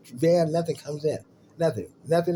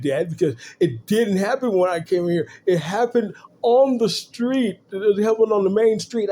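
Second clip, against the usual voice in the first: put-on whiny kid; 6 semitones higher, 5 dB louder than usual. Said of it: "He said".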